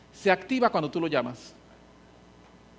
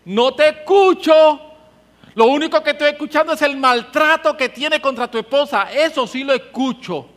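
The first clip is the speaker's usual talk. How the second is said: stern divine voice, raised; God rebuking; 7 semitones higher, 9 dB louder than usual. Acted. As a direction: scold like God who hears only the abuse. God's voice acted.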